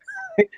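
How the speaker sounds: quick high laugh; a brief light laugh; short laugh. Real, not acted. Laughs about friend.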